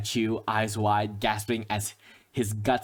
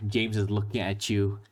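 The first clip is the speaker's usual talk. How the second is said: usual speaking voice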